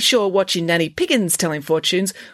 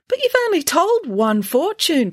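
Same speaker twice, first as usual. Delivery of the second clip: protesting; 12 semitones higher, 4 dB louder than usual. Acted. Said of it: "protested Michael".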